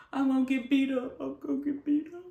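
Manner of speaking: hammed-up nervous muttering; paranoid worry. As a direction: fret anxiously to yourself. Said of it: "I'm paranoid".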